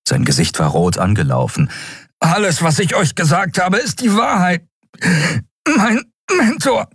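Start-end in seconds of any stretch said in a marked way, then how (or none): 2.2-6.9 hohe, gepresste Stimme unter Atemnot; mühsam herausgepresst, viel höher als die Erzählstimme; gewürgter Gefangener. Acted. (gepresst und atemlos)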